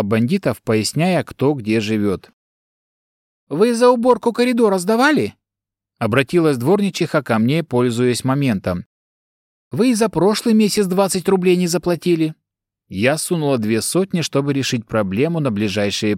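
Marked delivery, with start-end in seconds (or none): 3.5-5.3 high, shrill-sounding scolding janitor; nagging caretaker woman. Acted. 9.7-12.3 nagging caretaker woman. Acted.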